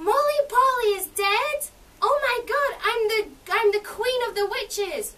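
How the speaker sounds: a witch cat shrieking in shock; witch queen cat. Acted. Orange cat crying out to Ethel and Donald, high, loud witch voice.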